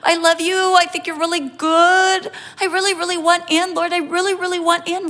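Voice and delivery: pleading believer. Pretend.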